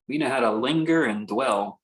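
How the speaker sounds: ordinary delivery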